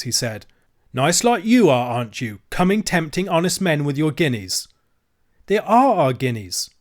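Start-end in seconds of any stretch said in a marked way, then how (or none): 0.9-4.7 louder, higher mocking scolding; scornful dealer. Dealer berates the children. 5.5-6.7 bold. Acted. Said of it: "said Cyril boldly".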